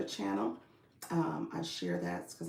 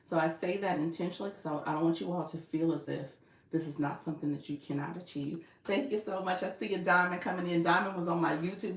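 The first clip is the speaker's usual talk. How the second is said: usual speaking voice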